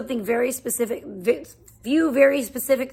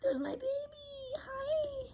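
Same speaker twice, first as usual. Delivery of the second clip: high, soft cooing greeting; 13 semitones higher, 16 dB quieter than usual. Real, not acted. Mother greeting her baby mid-stream, high soft coo. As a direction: coo up high to the little one.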